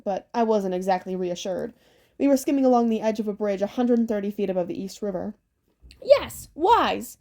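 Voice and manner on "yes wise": boastful hag. Acted.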